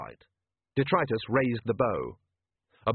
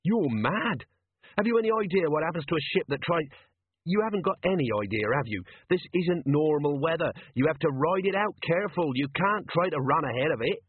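higher-pitched, exasperated character voice; agitated ship's captain; 5 semitones higher than usual. Acted. Captain protesting to Vimes about the storm, agitated.

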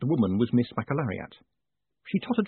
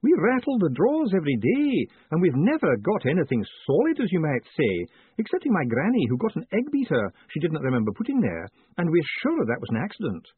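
higher-pitched, earnest clerk's voice; Miss McAlariat, earnest; 5 semitones higher than usual. Acted. Clerk telling Moist of the family's fruitless prayers, earnest and high. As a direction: explain earnestly in a raised character voice.

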